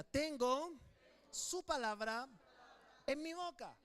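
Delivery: loud, believing declaration; said with conviction. Shown as a demonstration. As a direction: proclaim it like you truly believe it. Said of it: "pero ahora dígalo creyendo".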